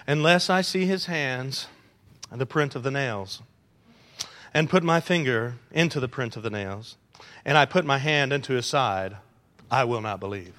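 soft, flat reading without attitude; plain reading. Shown as a demonstration. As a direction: read it low and mild, no edge. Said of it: "You can't read what Thomas said in a plain voice".

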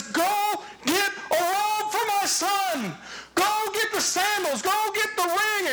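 the father beaming as he sends servants running; joyful father giving orders. Acted.

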